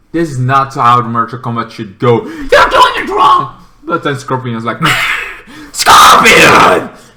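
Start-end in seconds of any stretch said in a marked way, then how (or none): 2.2-3.6 high, slow scolding yell; mock scolding shout. Scolding the plot. 5.7-7.0 loud breathy battle cry; shouted name. Retold or imitated.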